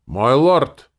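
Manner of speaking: loud, high herald's yell; bellowing. A narrator's reading. Soldier in dress uniform announcing to his lord, bellowing. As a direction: bellow the formal address. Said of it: "и заорал".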